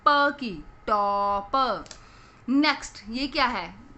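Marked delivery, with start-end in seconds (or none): 0.9-1.8 टॉप split into long "to" and "puh"; sounded out. Shown as a demonstration. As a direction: sound out टॉप with its last प on its own. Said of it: "last में".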